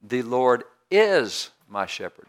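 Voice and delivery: is stretched long and loud; slow, emphatic line with a big drawn-out "is"; stress on IS. Shown as a demonstration. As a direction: lean hard and long on "is", then finish plainly. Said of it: "take one word and just emphasize it".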